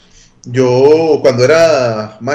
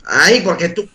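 slightly raised, puzzled voice; a hint of a higher kid's voice; imitating questioning classmate; 5 semitones higher than usual. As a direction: lightly mimic a nosy schoolmate. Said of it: "me llegaron a preguntar".

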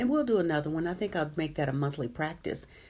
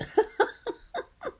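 a sudden high burst of laughter; 4 dB louder than usual. Real, not acted. Host laughing at a viewer's comment, high burst of laughter.

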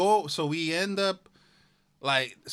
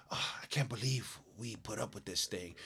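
softer mock grumble under the breath; under his breath; 6 semitones lower, 10 dB quieter than usual. Retelling a maskless man's grumble, under the breath. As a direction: mutter it like a man resenting the rule. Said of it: "And then under his breath".

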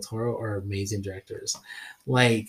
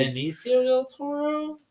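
incredulous echo; 13 semitones higher, slower than usual. Real, not acted. Repeats the wrong director name.

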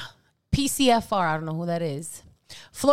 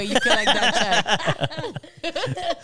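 slow, louder, stretched mock drawl; drawn-out tease; 4 semitones higher, 5 dB louder, much slower than usual. Ribbing a cohost about a fake sponsor deal, slow and stretched. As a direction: stretch every word to rub it in.

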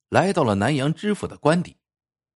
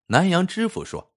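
usual speaking voice

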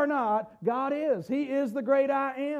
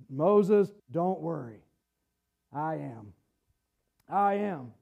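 gentle God voice; 9 semitones lower, 4 dB quieter than usual. Acted.

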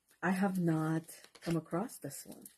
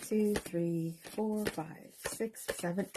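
ordinary talk, nothing marked

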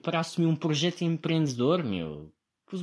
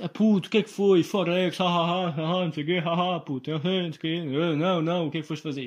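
mock friend; 4 semitones higher, 4 dB louder than usual. Friend's voice complaining, laughing.

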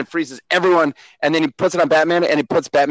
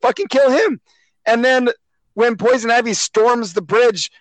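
higher, louder exasperated outburst; exasperated rant; 6 semitones higher, 3 dB louder than usual. Real, not acted. Ranting to friends about the plot, exasperated and loud. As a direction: vent at the dumb plot, voice up.

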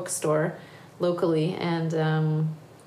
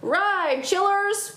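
high-pitched rallying call; urgent cry; 12 semitones higher, much slower than usual. Acted. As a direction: spur the riders on. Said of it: "cried Grandude".

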